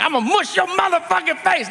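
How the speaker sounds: high mock tough-guy threat; threatening inmate. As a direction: bark it like a cartoon bully. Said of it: "he's like".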